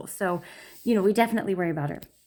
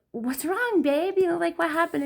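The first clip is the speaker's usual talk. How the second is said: put-on higher, gentle concern for a crying child; soothing mom; 9 semitones higher than usual. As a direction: mom comforting daughter.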